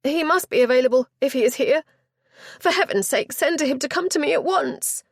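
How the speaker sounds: high, loud, fast frantic lady; a flustered chaperone insisting she be heard; high loud and hurried, close to panic; agitated duenna. Acted.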